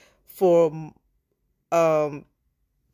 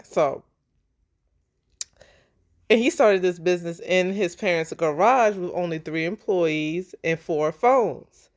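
ordinary talk, nothing marked

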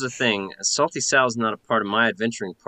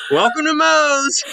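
high, loud bar greeting; loud laughing shout; 7 dB louder than usual. Pretend. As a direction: bellow it through laughter. Player shouts bar greeting quote.

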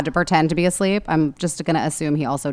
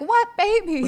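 put-on high, baffled "what baby"; confused Lucy; 14 semitones higher, slower than usual. Lucy confused when Jack mentions the baby. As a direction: squeak it out, totally lost.

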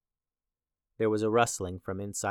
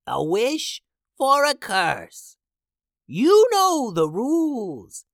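high, loud, slow witch shriek; 12 semitones higher, 7 dB louder, slower than usual. Acted. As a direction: screech it like a crone. Soothsayer character voice.

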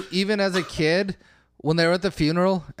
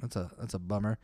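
low, soft mumble of disappointment; mock mumbled lament; 11 semitones lower, 13 dB quieter than usual. Viewer reacting softly to the crash scene. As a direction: mutter it under your breath. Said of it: "I'm like".

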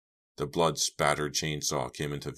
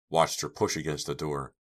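usual speaking voice

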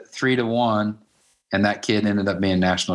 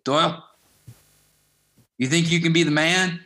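a higher, firmer voice for the coach; coach's challenge; 7 semitones higher than usual. Retold or imitated. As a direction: put the question to him like a tough coach sizing him up. Coach's blunt question retold.

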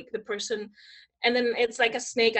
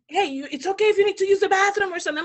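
imitated solicitous photographer, high and quick; hurried, high-pitched tactful offer; 7 semitones higher, 5 dB louder, faster than usual. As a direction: mimic someone awkwardly offering an escape. Photographer tactfully offers her a break.

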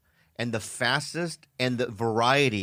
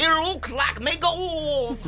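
very slow, high-pitched leprechaun wail; 13 semitones higher, much slower than usual. Acted. Leprechaun shaking the bag of gold, gleeful creature voice. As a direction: stretch it out in a high, hammed-up leprechaun voice. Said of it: "[name] is playing Lep".

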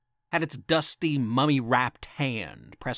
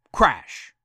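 loud crash; 6 dB louder than usual. Acted. Sound effect in spooky tale.

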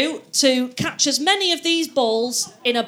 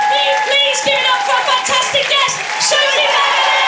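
announcer voice; 11 semitones higher, 4 dB louder than usual. Acted. Announcing guest to live audience.

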